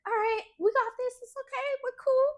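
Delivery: high, soothing mock-reassuring voice; calming reassurance. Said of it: "Alicia was like".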